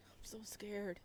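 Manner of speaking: scared whisper; a frightened whisper; whispered fear. Acted. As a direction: breathe it out, barely voiced. Frightened character line read.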